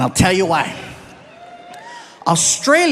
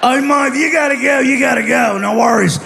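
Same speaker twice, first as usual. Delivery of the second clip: put-on drunk Aussie; 6 semitones higher, 4 dB louder than usual. Drunk pirate replies, raspy voice.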